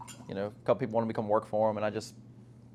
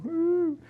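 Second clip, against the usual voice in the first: high-pitched playful whoop; party whoop. Pretend. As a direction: let out a little pool-party cheer. Party cheer while recalling pool days.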